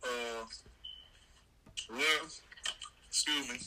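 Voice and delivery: talking while chewing; words round a bite of food; mouth full. Real, not acted. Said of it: "I'm eating".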